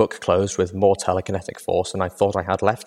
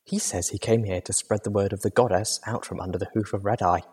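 gentle-volume companion voice; soft-spoken Velvet Remedy; 5 dB quieter than usual. Acted. Velvet raises a new topic with tired Little Pip, soft-spoken. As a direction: speak softly, politely pointed.

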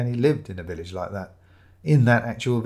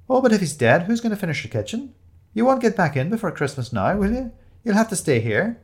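high, eager and loud; barely hiding glee; delighted; 11 semitones higher, 4 dB louder than usual. A narrator's reading. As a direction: Siobhan thrilled her friend must stay with her. Siobhan delighted Penny must stay.

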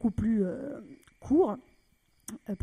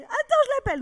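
much higher, loud cry; frantic aunt; 9 dB louder than usual. Retold or imitated. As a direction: shout it excitedly. (tante surexcitée, cri aigu)

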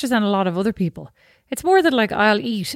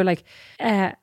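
ordinary talk, nothing marked

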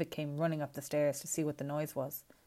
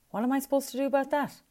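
high, loud, fast exasperated outburst; 10 semitones higher, 6 dB louder, faster than usual. A narrator's reading. Luca to his mother, loud fast exasperation. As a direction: fire it back at her, fed up.

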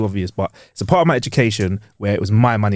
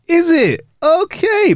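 high squeaky mock surprise; much slower than usual. Student hearing the grade is lowered, high squeaky disbelief. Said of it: "And I was like".

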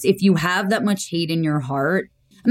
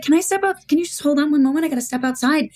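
flustered excuse; 8 semitones higher than usual. Retold or imitated. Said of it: "And I was like".

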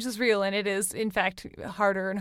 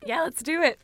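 voice cracking; 6 semitones higher, 5 dB louder than usual. Real, not acted. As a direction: voice breaking up and down. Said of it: "my voice is cracking like a teen boy".

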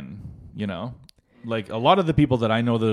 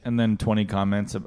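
ordinary talk

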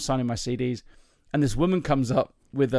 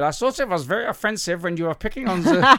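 higher, louder mock German complainer; offended German guest; 7 semitones higher, 5 dB louder than usual. German visitor scolding the comedian, mock-offended and loud. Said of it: "this German guy showed up and said".

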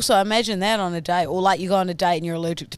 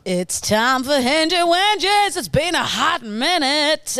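slow, higher show announcer; 6 semitones higher, 3 dB louder, slower than usual. Acted. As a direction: announce the segment grandly. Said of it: "show segment intro".

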